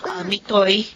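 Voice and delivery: ordinary talk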